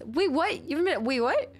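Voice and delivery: sudden high-pitched disbelief; shocked double take, voice jumping up; surprised. Real, not acted. Viewer says they are failing class.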